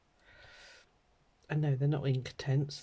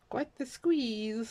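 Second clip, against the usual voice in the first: wry emphasis; 9 semitones higher, much slower than usual. Real, not acted. Downsizing to small house.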